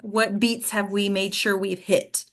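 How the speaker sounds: ordinary delivery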